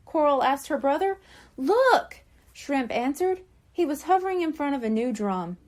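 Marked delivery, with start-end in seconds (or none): 1.6-2.2 high, loud burst; excited kid. A narrator's reading. Shrimp shows the girls his new drum, high and loud.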